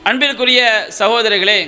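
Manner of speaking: ordinary talk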